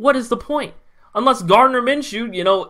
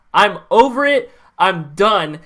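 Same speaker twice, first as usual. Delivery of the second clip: usual speaking voice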